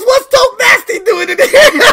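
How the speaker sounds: high excited quote breaking into laughter; laughing quote. Retold or imitated. Streamer retelling his surprise, laughing. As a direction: quote it while cracking up. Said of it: "He goes".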